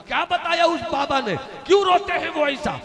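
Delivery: eager shouted question. Acted. Mother eagerly demands the answer.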